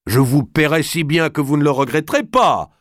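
high, loud, commanding noble; a forceful lord giving urgent orders; Robert d'Artois, bold lord. A narrator's reading.

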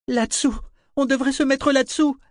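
put-on very high-pitched, urgent child's voice; urgent young boy. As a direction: call out high, urging the others to take cover. Boy urging shelter in collapsing room. (voix aiguë d'enfant, pressante)

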